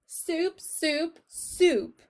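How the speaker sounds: high, repeated defiant soup; defiant repeating. Acted. Cook repeating the forbidden word at Louise, defiant. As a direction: keep saying soup, daring anyone to stop her. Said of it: "I will say it".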